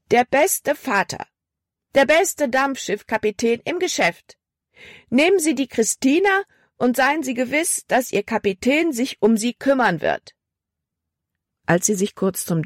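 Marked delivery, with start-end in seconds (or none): 0.1-10.3 high-pitched sales cry; loud call. A narrator's reading. Libby touts her father's steamboat to passengers, high calling voice. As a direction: proclaim it high, like a crier touting for trade. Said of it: "rief sie laut".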